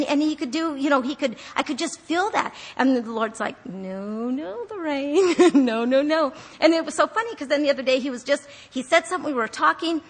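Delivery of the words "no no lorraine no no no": slow, teasing no-no, breaking into laughter; amused gentle correction. Retold or imitated. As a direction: laugh through a drawn-out admonishment. Voicing the Lord's playful correction.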